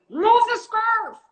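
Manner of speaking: high, loud, drawn-out mock yell; demonstrated yelling. Shown as a demonstration. Showing co-host the crew voice, high yell. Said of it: "is it the one where I'm yelling".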